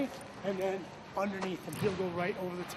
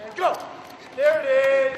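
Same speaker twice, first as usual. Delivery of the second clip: loud, high shout of go then praise; loud call; 6 semitones higher, 10 dB louder, slower than usual. Real, not acted. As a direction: shout the start and cheer it. Coach starting drill, cheering.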